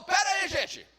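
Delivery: high, loud angry shout; furious leader shouting them down; angry Moses. Acted. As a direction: yell at the crowd to stop. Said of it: "Moisés ficou bravo e falou".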